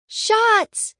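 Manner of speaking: high, loud party yell. A narrator's reading. Classmate calling for drinks at a bachelorette party, yelling.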